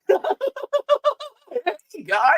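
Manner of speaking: squeaky high clown voice. Shown as a demonstration. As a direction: chirp it like a silly clown. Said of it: "and then clown is".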